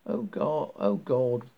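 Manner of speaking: ordinary delivery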